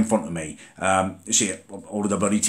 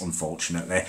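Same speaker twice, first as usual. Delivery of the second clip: ordinary delivery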